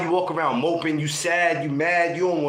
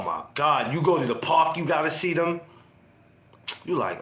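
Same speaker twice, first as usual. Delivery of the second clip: usual speaking voice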